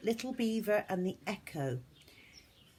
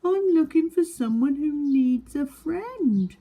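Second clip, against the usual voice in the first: high, loud eager little voice; little beaver voice; 9 semitones higher, 11 dB louder than usual. Acted. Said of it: "said Little Beaver".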